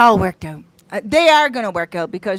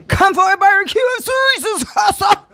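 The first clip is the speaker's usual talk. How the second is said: mock invitation; 8 semitones higher than usual. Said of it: "I'm joking".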